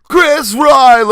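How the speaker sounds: shouted, high, drawn-out name call; hammed-up announcer. Host calling out the next guest, hammed-up announcer. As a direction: stretch the last name like a ring announcer.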